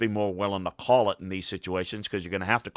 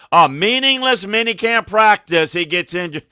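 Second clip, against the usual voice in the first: mocking media; 10 semitones higher, 8 dB louder than usual. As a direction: sneer at what the media always says. Mocking media reaction to injuries.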